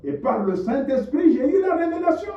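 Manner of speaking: high, loud testimony with fervor; impassioned preaching. Acted. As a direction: proclaim it to the whole church. Preaching on the meaning of the voice. (témoignage prêché avec ferveur, voix haute et forte)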